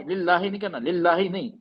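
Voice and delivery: usual speaking voice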